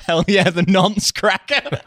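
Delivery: high, slow, helpless laughing cheer; laughing too hard to speak evenly; real laughter. Real, not acted. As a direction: crow it through fits of laughter. Laughing hard at cracker's wording.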